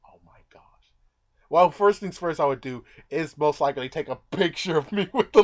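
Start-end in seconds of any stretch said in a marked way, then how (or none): 0.1-0.6 breathless whisper; scared whisper; whispered panic. Pretend. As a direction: hush it out in fear. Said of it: "freaking out saying".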